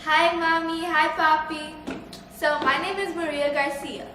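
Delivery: ordinary talk, nothing marked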